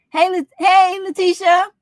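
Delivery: cheerful high call to Letitia. Retold or imitated. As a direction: hail her across the room. Greeting a friend by name.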